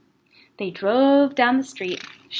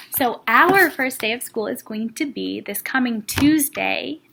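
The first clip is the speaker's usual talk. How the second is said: ordinary delivery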